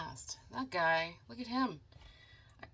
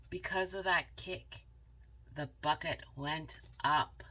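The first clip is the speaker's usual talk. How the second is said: plain reading voice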